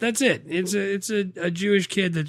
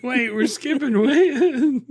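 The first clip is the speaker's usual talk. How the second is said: high, slow, through laughter; laughing protest, much higher; laughing surprise; 9 semitones higher, 4 dB louder, slower than usual. Real, not acted. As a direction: caught off guard, laughing. Surprised by the soundbite.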